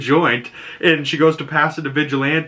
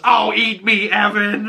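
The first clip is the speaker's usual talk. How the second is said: high-pitched bickering comeback; mock retort; 9 semitones higher than usual. Amber answering her angry boyfriend, high mock-annoyed retort. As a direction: imitate a girlfriend hitting back, voice pitched up. Girlfriend snapping back in retold quarrel.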